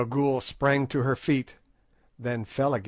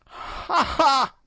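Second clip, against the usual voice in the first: high piping crone's laugh; a shrill little mocking cackle, much higher; old hag laughing high in scorn; piped laugh; 4 dB louder than usual. A narrator's reading. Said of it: "she piped".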